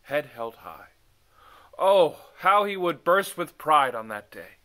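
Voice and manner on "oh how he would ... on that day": proud, rising excitement; swelling with pride. A narrator's reading. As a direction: let the pride burst out. Narrator swells with feeling.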